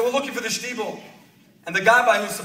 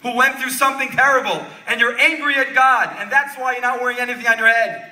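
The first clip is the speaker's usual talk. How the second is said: raised, emphatic storytelling at a high pitch; impassioned preaching; 4 semitones higher, 6 dB louder than usual. A narrator's reading. Rebbe explains the bareheaded man's anger, retold with preacher's fervor. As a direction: drive each phrase home to the congregation.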